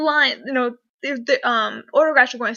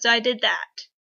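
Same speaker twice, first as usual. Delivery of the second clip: ordinary talk, nothing marked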